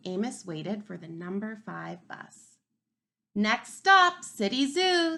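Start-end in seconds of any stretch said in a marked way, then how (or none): 3.4-5.2 much louder, higher calling out of stops; bus driver calling. A narrator's reading. Bus driver announcing the stop to riders, loud call. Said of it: "the bus driver would call".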